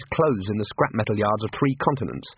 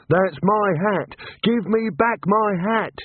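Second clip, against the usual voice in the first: higher, slow outraged whine; indignant wizard; 9 semitones higher, slower than usual. Acted. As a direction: demand it back in high indignation. Rincewind demands his hat.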